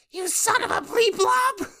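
high, slow squeaky voice; squirrel voice. Retold or imitated. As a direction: pitch it way up and drag it. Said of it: "I can't quite do the squirrel's voice".

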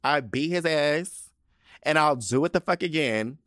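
high, loud, unrepentant guilty plea; hammed-up defiant courtroom confession; proud defendant. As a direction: proclaim it to the judge with relish. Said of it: "What do you plead?".

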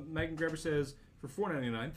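ordinary talk

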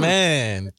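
ordinary talk

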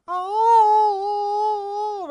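loud, very high drawn-out oh; shrieking oh, way up high; high loud wail. Retold or imitated. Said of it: "right in her face".

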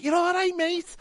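very high camp greeting; a high, flamboyant hello from the back seat; second passenger mimicked, very high and camp; camp drag voice. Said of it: "And he was like".

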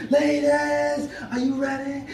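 very high imitated hype call; held-back, high-pitched MC scream; mock stage scream. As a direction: imitate screaming to rev up a crowd. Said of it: "I'd scream".

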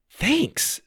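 bright, high thank-you; thrilled and grateful; delighted. Acted.

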